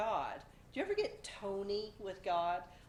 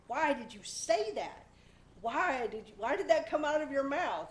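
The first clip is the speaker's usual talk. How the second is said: louder, higher frustrated scolding of herself; frustrated self-scolding; 5 semitones higher, 5 dB louder than usual. Retold or imitated. Scolding herself alone at night, frustrated and louder. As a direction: scold yourself for what slipped out. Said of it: "a frustration".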